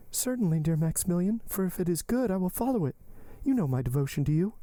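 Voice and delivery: high-pitched heroine, earnest assurance; Valentine, devoted. Acted. Valentine assuring Maximilian of her devotion, earnest.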